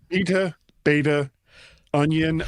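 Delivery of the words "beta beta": first "beta" BEE-tuh, second "beta" BAY-tuh; two betas contrasted. Shown as a demonstration. Comparing two pronunciations of beta.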